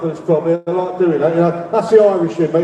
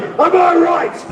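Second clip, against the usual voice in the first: higher, drawn-out call to the crowd; rally call-out; 6 semitones higher, slower than usual. Shown as a demonstration. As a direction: fire the question at the crowd. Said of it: "I want you to say this".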